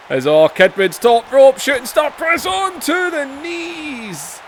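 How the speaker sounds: rising excited wrestling commentary call; play-by-play. Acted. Calling the match he's playing, excited play-by-play. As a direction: call the top-rope move like a live announcer.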